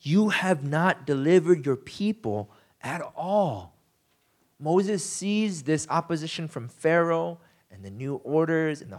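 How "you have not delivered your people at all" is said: a slow, weighty accusation; slow solemn verdict. Retold or imitated. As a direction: lay the charge down slowly. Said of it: "He concludes".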